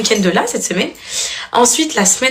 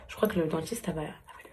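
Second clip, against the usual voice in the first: whisper; 5 semitones lower, 15 dB quieter than usual. Real, not acted. Mother whispering an aside about the dentist, hushed.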